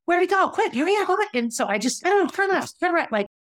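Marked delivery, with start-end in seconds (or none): 0.1-1.3 frantic high yelp, hurried commands; high-pitched yelling. Retold or imitated. Boyfriend driving lost out of Boston, high-pitched yelling. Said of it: "he gets very high pitched when he's yelling". 2.1-3.1 retold high, flustered directions; high-pitched yelling. Driving lost out of Boston, retold high-pitched yelling. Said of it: "he gets very high pitched when he's yelling".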